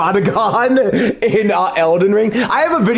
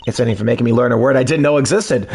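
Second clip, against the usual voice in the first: ordinary talk, nothing marked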